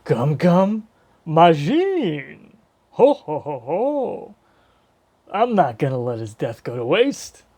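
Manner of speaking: hammed-up genie voice with big swooping pitch and drawn-out laughs; Robin Williams. Fan imagines a voice actor playing Luffy's genie form, hammed-up impression. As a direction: attempt a booming cartoon genie impression, badly faked. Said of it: "a Robin Williams-esque voice for him".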